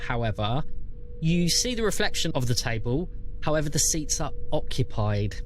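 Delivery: ordinary talk, nothing marked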